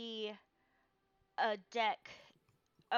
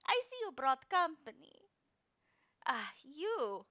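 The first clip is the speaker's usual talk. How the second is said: put-on a higher, knowing old woman; old fortune teller; 6 semitones higher, 5 dB louder than usual. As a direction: point out the newcomer slyly. Madame Eva voice.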